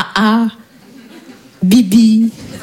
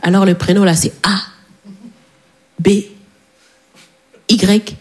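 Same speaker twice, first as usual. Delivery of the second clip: lower, halting father at the desk; father at registry; 6 semitones lower, slower than usual. Retold or imitated. Father registering her name, lower halting voice. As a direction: drop low and spell it out haltingly. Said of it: "comme ça".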